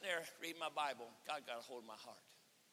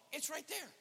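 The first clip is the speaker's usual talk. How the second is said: raised pitch at ordinary volume; high matter-of-fact pointing; 10 semitones higher than usual. Retold or imitated. Cashier showing a customer the street, high and unshouted.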